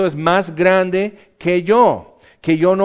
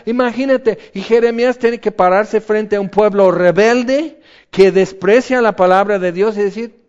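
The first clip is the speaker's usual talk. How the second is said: ordinary talk, nothing marked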